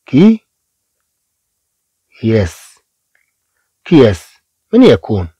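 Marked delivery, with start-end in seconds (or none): 0.1-2.8 teacher dissects the word for pupils; split syllables, second whispered. Shown as a demonstration. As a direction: break كياس into كي and a whispered ياس. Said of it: "نقول".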